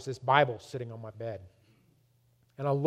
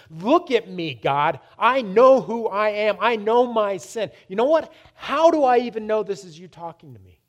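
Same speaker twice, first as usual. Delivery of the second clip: loud, high, emphatic outburst; retold yelling at God; 5 semitones higher, 8 dB louder than usual. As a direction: yell back defiantly, stretching the words. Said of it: "I yelled back at God".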